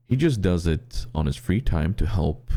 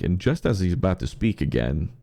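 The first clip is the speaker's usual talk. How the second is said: ordinary talk, nothing marked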